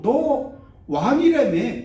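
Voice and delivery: very slow, high, loud sneer; mocking. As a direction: drag it out to jeer at him. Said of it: "비웃으려고".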